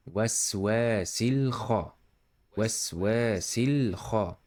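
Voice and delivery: slow "Waswasil kha" with long waa and rasped kh; throaty kha. Shown as a demonstration. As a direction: draw out "Waswasil", then scrape into "kha". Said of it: "throaty kha".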